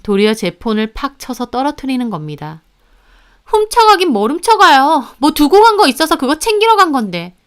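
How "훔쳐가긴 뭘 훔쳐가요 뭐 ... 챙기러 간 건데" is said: high, loud defensive protest; shrill-edged, affronted excuse-making; indignant denial. Acted. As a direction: deny it as if wrongly accused. Sister denies stealing the recipe.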